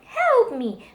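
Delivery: high, loud desperate plea; cry for help. A narrator's reading. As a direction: call home in distress. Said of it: "ran home and cried".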